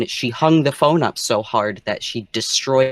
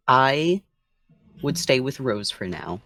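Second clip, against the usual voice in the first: normal talking voice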